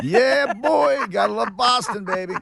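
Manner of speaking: high, laughing "gotta love Boston"; hammed-up hype. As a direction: play up the mock hostage joke. Jokes about being held hostage.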